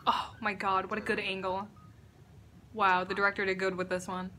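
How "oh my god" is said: ordinary talk, nothing marked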